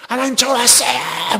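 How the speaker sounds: high, loud demonstration of crying out to God; acted full-voiced prayer shout. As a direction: yell it skyward, stretching the words. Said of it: "소리 질러서 기도해".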